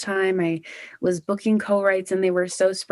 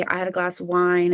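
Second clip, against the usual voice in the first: ordinary talk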